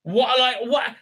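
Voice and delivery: ordinary delivery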